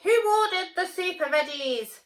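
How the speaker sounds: high, loud villain screech; high loud screeching pea. Acted. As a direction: screech in outrage. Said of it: "screeched the evil pea".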